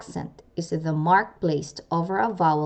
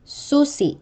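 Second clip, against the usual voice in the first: Susi with high stressed Su-, abrupt catch after the i; penultimate glottal stress; 8 semitones higher than usual. Shown as a demonstration. Teacher showing glottal catch, Susi stressed on first syllable. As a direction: stress the start of Susi, cut the end off. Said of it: "pronounced with a glottal catch".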